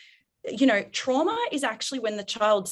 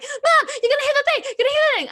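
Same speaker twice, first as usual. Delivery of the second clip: much higher and louder, alarmed warning; panicking kid; 7 dB louder, faster than usual. Retold or imitated. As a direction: squeal it like a scared child in the back seat. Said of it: "She was like".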